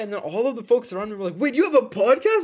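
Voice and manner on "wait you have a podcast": high, loud wait; mock surprised question. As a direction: gasp it out in surprise. Classmates surprised.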